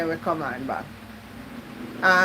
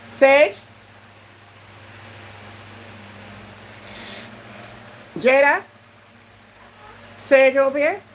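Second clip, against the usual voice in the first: loud call across the room, much higher; 7 semitones higher, 4 dB louder than usual. Real, not acted. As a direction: shout the names to someone out of sight. Calling out to people nearby.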